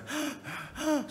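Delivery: a high gasping pant; mock breathless gasp. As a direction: act out a climber out of breath. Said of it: "sudah tidak ada napasnya". (terengah-engah pura-pura kehabisan napas)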